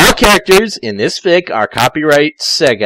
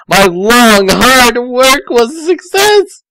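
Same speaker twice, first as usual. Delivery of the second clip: put-on high, loud, slow girlish cheer; triumphant girl voice; 10 semitones higher, 6 dB louder, slower than usual. Tails celebrating the working submarine in a high voice. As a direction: crow over the success.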